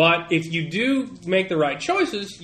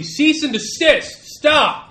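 loud, high guard ordering halt; commanding guard; 10 semitones higher, 6 dB louder, much slower than usual. Acted. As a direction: bark a halt order, slowly.

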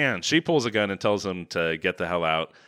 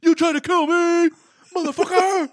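mock yell. Host imitates Cage shouting at Diane, high and hammed-up. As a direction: shout it like a paranoid madman. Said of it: "and then just goes".

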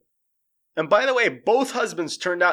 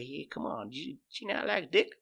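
creepy coaxing; 8 dB quieter than usual. Retold or imitated. Joe quietly coaxing the new husband with weed and meth. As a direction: sweet-talk him quietly into it. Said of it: "you can hear his voice say".

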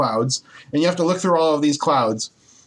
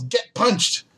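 mock yell; 7 semitones higher than usual. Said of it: "My favorite one, he yells".

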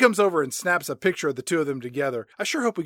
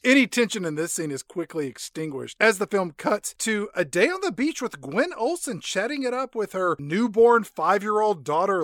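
ordinary talk, nothing marked

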